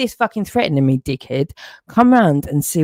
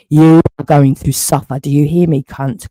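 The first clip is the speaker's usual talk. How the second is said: louder, menacing yell; furious threat; 5 dB louder than usual. Real, not acted. Man threatens his accuser, louder and menacing. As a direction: shout the threat straight at him.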